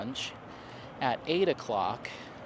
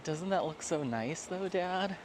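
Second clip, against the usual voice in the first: higher, soft pretend pet voice; dog's voice; 6 semitones higher, 5 dB quieter than usual. Dog begging Dad for a swim, pretend pet voice. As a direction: speak for a dog pleading with its owner.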